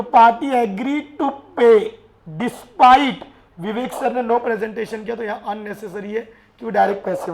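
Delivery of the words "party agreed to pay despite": each word spaced out slowly and louder for note-taking; slow dictation. Shown as a demonstration. Teacher slowly dictating the rule to students. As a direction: dictate it so the class can write.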